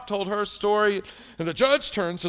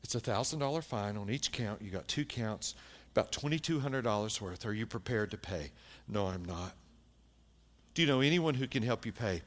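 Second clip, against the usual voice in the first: quiet stern judge; 8 semitones lower, 8 dB quieter than usual. Retold or imitated. Judge sentences the woman quietly.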